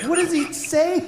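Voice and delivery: put-on high child voice; little daughter. Daughter asks what the seal says in high voice. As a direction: ask like a curious kid. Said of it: "My daughter's like".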